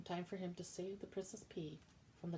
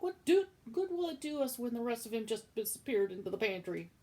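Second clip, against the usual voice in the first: loud, higher scolding; angry shout; 6 semitones higher, 10 dB louder than usual. Acted. Cook scolding Midge about the lost mouse, loud angry shout. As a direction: scold her loudly. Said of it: "shouted Cook".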